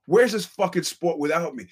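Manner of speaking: mock boss demanding sympathy; mock arrogant boss. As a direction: sneer at critics as the boss. Mimicking boss self-justifying.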